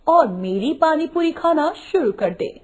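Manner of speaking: usual speaking voice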